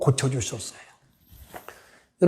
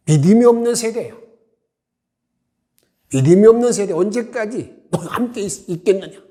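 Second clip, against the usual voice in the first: raised, loud scolding of a faithless generation; stern rebuke; 5 semitones higher, 4 dB louder than usual. Retold or imitated. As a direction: rebuke them, voice lifted and hard. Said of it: "책망하십니다". (높고 큰 소리로 꾸짖듯이)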